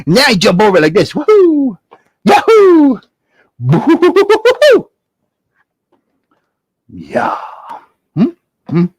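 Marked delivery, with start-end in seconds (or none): 1.1-4.9 high joyful whooping; gleeful woo-hoo; excited whoops. Acted. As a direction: whoop as you leap. Jumping over candle.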